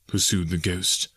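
usual speaking voice

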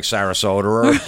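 "Sarasota" said as "Sarasoter"; R on the end. Acted. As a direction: punch "Sarasota" with an -er ending. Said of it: "we have the R's".

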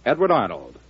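ordinary talk, nothing marked

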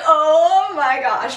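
Lily gasping as the pieces fit; excited gasp. Retold or imitated. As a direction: savor the realization.

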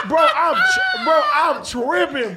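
worked-up laughing shout; excited outburst. Real, not acted. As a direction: blurt it out half shouting.

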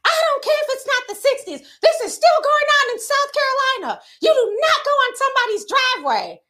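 high, loud imitation of a firm older woman; lightly voiced mother figure laying down the law; older woman scolding. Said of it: "She was like".

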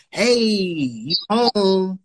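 long drawn-out mock greeting; mock welcome. Man imitates a partner waiting on the couch, drawling a mock welcome. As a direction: stretch the hey out lazily.

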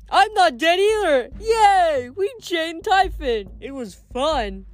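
put-on high, gleeful childlike Cyclops; high-pitched, slow and delighted; happy Cyclops voice. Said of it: "laughed happily".

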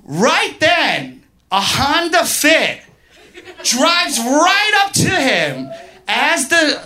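high, drawn-out exclamations, each phrase punched out; dramatic play-by-play. Real, not acted. Comic telling the crowd of instant revenge, high and thrilled. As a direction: call the action like an astonishing climax. Builds climax of the karma story.

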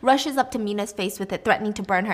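ordinary talk, nothing marked

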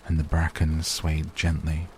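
ordinary delivery

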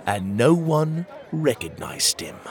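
ordinary delivery

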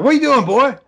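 high, loud mocking bark at a kid; imitating Daniel's yell. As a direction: call him boy like a bully would. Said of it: "and he's like".

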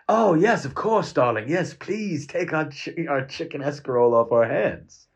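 overly delighted shopkeeper calling him darling; sweet shopkeeper. Retold or imitated. Friendly shop sells happily.

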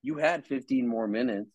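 low, sneering accuser voice; Satan's taunt. Retold or imitated. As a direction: needle them like a tempter. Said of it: "Satan will come and say".

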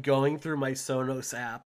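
usual speaking voice

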